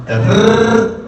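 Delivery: rrr trilled long; rolled R demo. Shown as a demonstration. Comic showing the crowd how to roll the rrr. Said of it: "roll your R's".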